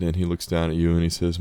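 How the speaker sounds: normal talking voice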